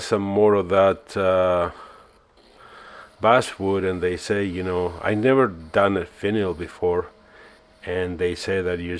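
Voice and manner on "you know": ordinary talk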